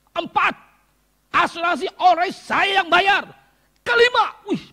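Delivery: high, loud, jubilant preaching; excited preaching. Acted. (khotbah penuh semangat, tinggi dan keras)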